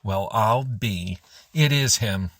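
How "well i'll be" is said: ordinary delivery, nothing marked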